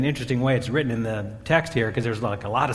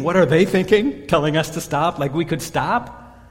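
incredulous indignation; 4 semitones higher, 5 dB louder than usual. Retold or imitated. Disciples' indignant inner thought.

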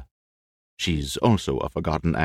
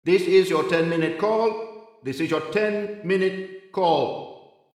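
hushed countdown cues; 11 semitones higher, slower than usual. Acted. Distant stage manager voice calling.

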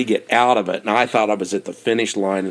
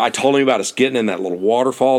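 normal talking voice

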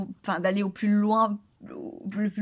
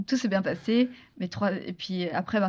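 ordinary delivery

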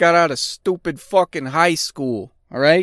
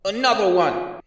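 DJ hype call; 6 semitones higher than usual. Retold or imitated. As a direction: announce it like a DJ shouting his tag at the top of a song. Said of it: "The D J has to announce their name".

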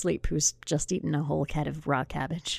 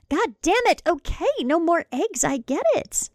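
annoyed outburst; 11 semitones higher, 5 dB louder than usual. Acted. Pregnant wife cursing a kick.

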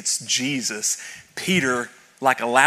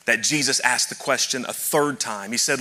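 usual speaking voice

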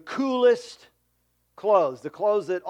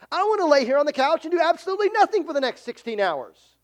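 put-on higher, whining kid protesting chores; whiny child; 7 semitones higher, 4 dB louder, faster than usual. Pastor mimicking a child refusing chores, whiny. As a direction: mimic a sulky child who won't help.

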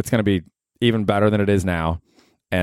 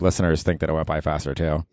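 ordinary talk, nothing marked

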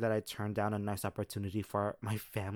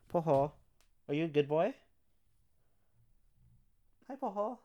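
pet talk; 7 semitones higher, 6 dB louder, faster than usual. Real, not acted. Talking to her dog.

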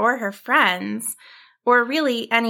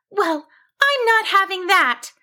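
high-pitched, determined sheep; firm little character voice, pitched up; 10 semitones higher than usual. A narrator's reading. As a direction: put your foot down in a high voice. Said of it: "she woofed firmly".

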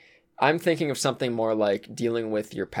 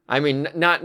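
ordinary delivery

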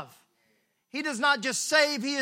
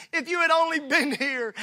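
slightly raised, only mildly grieving; mild lament; 4 semitones higher, 5 dB louder than usual. Acted. Mary complaining to Jesus, mildly grieving. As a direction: say it with a hint of grief. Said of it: "there she goes again".

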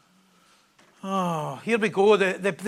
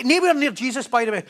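normal talking voice

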